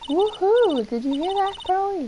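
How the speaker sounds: high, whooping delight; excited cheer. Acted. Maya cheers to Pearly over praise, excited and high. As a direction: whoop it high and share the thrill. Game character celebrates praise.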